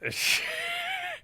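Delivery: very high breathy laugh; high giggle. Real, not acted. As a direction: break into a squeaky laugh.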